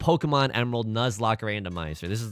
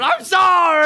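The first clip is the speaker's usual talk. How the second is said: very high, loud drawn-out yell; yelled mock apology; 11 dB louder, slower than usual. A tile apologizing to the player, screamed in mock anguish. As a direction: scream the apology, stretching the last syllable. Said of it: "another tile that's like".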